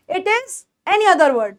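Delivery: ordinary talk, nothing marked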